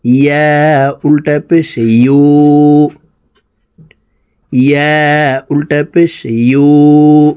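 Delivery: slow sing-song spelling chant; patient lesson chant, lines drawn out; drill recitation. Shown as a demonstration. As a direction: recite each letter for the class to echo. Teacher drills letter recitation.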